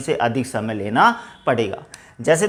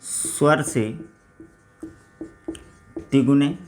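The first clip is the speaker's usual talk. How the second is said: slow, low-key dictation with long pauses; 6 semitones lower, 7 dB quieter, slower than usual. Acted. Teacher dictating the pluta vowel definition, measured dictation. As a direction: dictate it phrase by phrase, leaving writing time.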